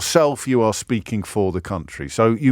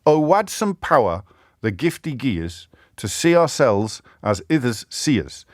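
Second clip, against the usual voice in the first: a light Scottish lilt, reading Burns; half-hearted Scottish accent on a Burns poem; reading Rabbie Burns with a hint of Scottish accent; 5 semitones higher than usual. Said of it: "and I won't do the accent".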